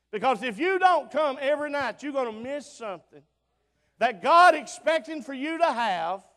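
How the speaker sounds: loud, rising revival preaching; preaching at full force. Acted. Preacher scolding his congregation in loud revival preaching. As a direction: drive it home to the pews.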